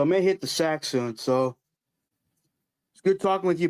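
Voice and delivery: ordinary delivery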